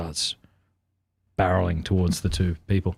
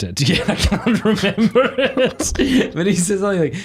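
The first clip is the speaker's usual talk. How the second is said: laughing; 10 semitones higher than usual. Real, not acted. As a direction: laugh helplessly at your own lapse. Laughs at forgetting the joke.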